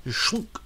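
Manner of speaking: a high, squelchy schlunk with a rising end; wet schlunk noise. Retold or imitated. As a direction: imitate the hook squelching in. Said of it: "wet schlunk sound".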